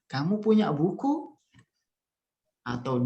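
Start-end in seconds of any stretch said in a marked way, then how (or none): 0.1-1.4 Kamu punya buku pitched high, rising on buku; question intonation. Shown as a demonstration. Teacher repeats the example for class, rising questioning intonation. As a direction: ask it with buku lifted at the end. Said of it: "Intonasinya kira-kira seperti itu".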